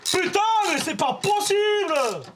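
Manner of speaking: high, loud, slow exasperated yell; furious shout. Real, not acted. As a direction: bellow it out, at wits' end. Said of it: "tu me cries dans mes oreilles".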